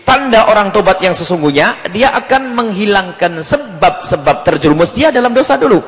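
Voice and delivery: ordinary talk, nothing marked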